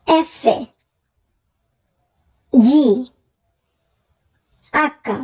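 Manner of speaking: ordinary talk, nothing marked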